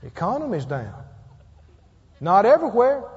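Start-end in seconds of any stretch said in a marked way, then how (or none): 2.2-3.0 emphatic rebuttal. Real, not acted. Preacher rebuts gloom loudly.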